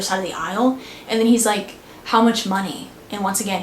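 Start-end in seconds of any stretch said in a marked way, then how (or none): none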